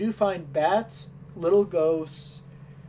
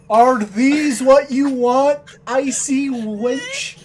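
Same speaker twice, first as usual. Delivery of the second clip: slow, louder, higher mock question; slow mocking shopkeeper; 5 semitones higher, 5 dB louder, slower than usual. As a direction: drag it out with contempt. Shopkeeper offers bows.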